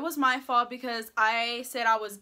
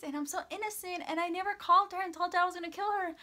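higher, sweet mock-innocent voice; mock innocent good girl; 7 semitones higher, faster than usual. Mocking herself as the nice innocent girl, higher sweet voice. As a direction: play the angelic little girl, tongue in cheek. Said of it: "the little good girl that I am".

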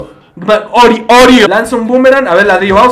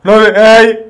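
nervous; 4 dB louder, much slower than usual. Real, not acted. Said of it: "me puse nervioso".

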